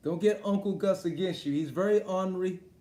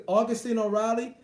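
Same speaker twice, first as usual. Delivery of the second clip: normal talking voice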